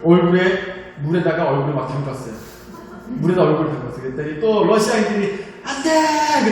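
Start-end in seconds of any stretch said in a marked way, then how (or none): 5.6-6.5 high mock yell of "no"; retold shout. Russians warning him off the water, high mock yell. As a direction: cry out a warning, half-acted. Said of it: "그러는 거예요".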